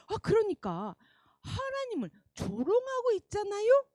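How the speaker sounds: ordinary talk, nothing marked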